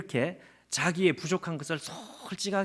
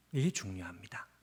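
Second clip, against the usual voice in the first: softer, breathy stage whisper for weight; hushed emphasis; 8 semitones lower, 8 dB quieter than usual. Real, not acted. Preacher hushes the congregation on the key point, whispering.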